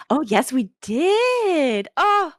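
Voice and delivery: high, slow, drawn-out gushing; high syrupy mom, slowly; Honey the mom. Acted. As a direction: savour it, syrupy sweet. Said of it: "she goes".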